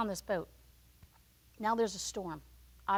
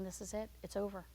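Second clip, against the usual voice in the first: much softer, giving up; fading resignation; 2 semitones lower, 10 dB quieter than usual. Acted. Jonah sinking in the sea, soft surrender. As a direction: let it fade out.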